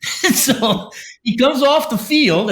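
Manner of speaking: laughing. Real, not acted. Laughing while retelling the story.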